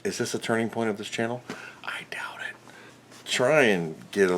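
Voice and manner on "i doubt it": whispered aside. Real, not acted. Aside doubting his own resolve.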